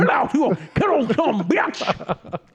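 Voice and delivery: high, slow voodoo witch drawl; voodoo witch accent. Retold or imitated. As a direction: stretch it out like a bayou witch. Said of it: "talking like a voodoo witch".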